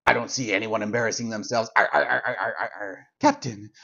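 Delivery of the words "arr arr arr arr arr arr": put-on slurred low drunken pirate grunts; woozy arr arr, lightly voiced. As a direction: groan it out like a drunk. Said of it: "Geordi is acting intoxicated".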